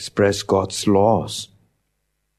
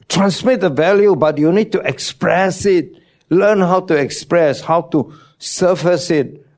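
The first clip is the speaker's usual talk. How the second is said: raised, louder preaching push; emphatic preaching; 8 semitones higher, 4 dB louder than usual. Real, not acted. Pastor urging parents, loud emphatic preaching. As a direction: drive the point home to the congregation. Preacher driving point home.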